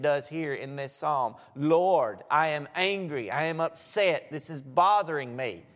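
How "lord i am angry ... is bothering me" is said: loud, raw cry of frustration to God; David crying out to the Lord, loud and blunt; blunt angry prayer. Retold or imitated. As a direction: pour out anger out loud, pleading at the end. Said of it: "say as bluntly as David".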